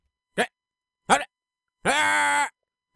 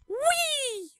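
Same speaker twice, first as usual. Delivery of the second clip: high-pitched, drawn-out squeal of whee; 14 semitones higher, 4 dB quieter than usual. Pretend. Player riding along with the game character, high playful whee. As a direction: let out a long, high whee.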